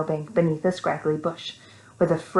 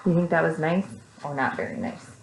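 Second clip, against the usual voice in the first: ordinary delivery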